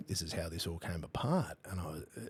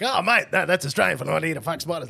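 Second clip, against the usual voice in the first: high, loud, laughing "Oh, mate, no"; laughing as he explains the Aussie saying to his UK mate; laughing explanation; 11 semitones higher, 15 dB louder than usual. Retold or imitated. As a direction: cheerfully set him straight.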